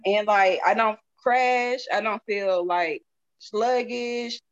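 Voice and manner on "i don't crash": ordinary delivery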